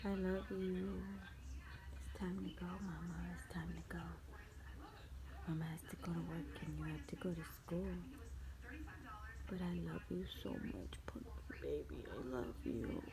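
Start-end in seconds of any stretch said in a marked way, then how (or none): 2.0-13.0 soft, tearful whispered goodbye. Real, not acted. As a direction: whisper it, holding back tears.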